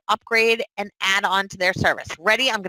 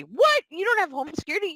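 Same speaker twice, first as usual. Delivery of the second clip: mock shock; 9 semitones higher, faster than usual. Mock sales call, shocked agent.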